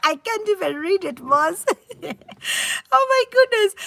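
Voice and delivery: words broken up by real laughter; laughing too hard to read a comment aloud. Real, not acted. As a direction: laugh through the line, half helpless. Laughing over a pidgin comment.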